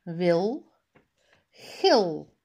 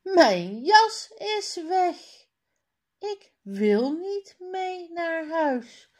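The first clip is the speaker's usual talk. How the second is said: high, loud upset cry; boy refusing to leave without his coat; calling out upset; 10 semitones higher, 4 dB louder than usual. A narrator's reading. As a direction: wail it like a stubborn boy. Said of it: "roept Jan".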